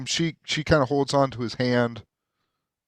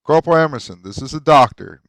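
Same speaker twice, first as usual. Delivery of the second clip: commanding captain; 3 semitones higher, 7 dB louder than usual. Acted. Captain introduces the doctor to his corporal, lightly commanding. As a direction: address a subordinate with raised voice.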